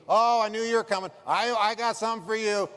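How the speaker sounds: an eager dealer who can't wait to show you. Retold or imitated. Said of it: "The guy could not wait to see me".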